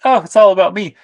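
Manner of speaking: mock her as self-centred and whiny; imitating Demi. Host mimicking Demi as self-centred, in a high mocking whine. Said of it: "imitating Demi".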